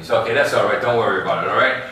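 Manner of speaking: ordinary talk, nothing marked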